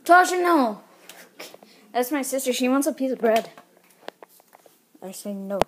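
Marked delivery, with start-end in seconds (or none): 0.0-0.8 loud, high scolding; firm scolding no. Real, not acted. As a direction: tell her off sharply. Scolding sister for bread.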